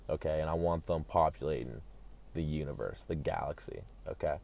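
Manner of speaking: lightly voiced secret admission; Elon confessing. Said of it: "Elon has revealed to a few guys".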